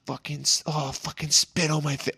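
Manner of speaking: soft mock moan of a lover; imitated bedroom talk.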